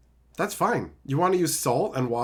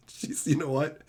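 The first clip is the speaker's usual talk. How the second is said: 1 whisper; 3 semitones lower, 7 dB quieter than usual. Real, not acted. Sudden aside about wife.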